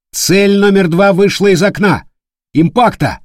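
high, loud battle report; shouting. Acted.

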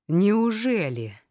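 slow, high, loud scornful "really?"; sarcastic. A narrator's reading. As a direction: drag it out in cold disbelief. Furious sarcastic retort.

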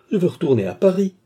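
high, loud desperate plea; desperate insistence. A narrator's reading. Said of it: "avec un subit désespoir". (supplique désespérée, aiguë et forte)

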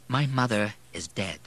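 usual speaking voice

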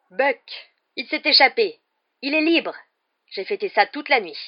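annonce triomphante, voix haute et forte; joie débordante. A narrator's reading. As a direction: crier sa joie d'une nouvelle libération. Hagrid annonce joyeusement une nouvelle. (Hagrid, ravi, clame la fuite de Buck, voix haute et forte)

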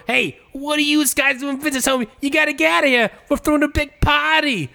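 high-pitched, loudmouthed buddy character voice; Turtle impression. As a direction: call it out in a high, put-on character voice. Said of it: "have Turtle come on and go".

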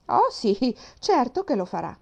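mock the bookish captain; sarcastic. A narrator's reading. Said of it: "esclamò Mary in tono sarcastico". (tono sarcastico, esclamato)

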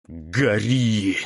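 strained through gritted teeth. A narrator's reading. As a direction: force it out through clenched teeth. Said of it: "сквозь зубы повторял он".

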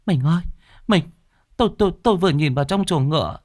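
high, stammering, frightened woman's voice; terrified, panting. A narrator's reading. Terrified wife tells husband what she saw in the stable, gasping and stammering. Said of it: "Cô Hoa mặt cắt không còn giọt máu hổn hển".

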